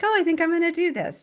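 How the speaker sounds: light, airy and high, tossed off fast; breezy as if it's nothing at all; mock nonchalant. Recalling how casually she took on the ministry, mock nonchalant. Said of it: "I say it very nonchalantly".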